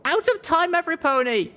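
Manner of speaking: high, urgent shout to everyone; high-pitched alarm call. Acted. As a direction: yell the warning over the collapse. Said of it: "I shouted".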